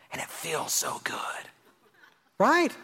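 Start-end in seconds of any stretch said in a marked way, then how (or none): 0.1-1.4 imitated whisper. Pastor voices confessing congregant.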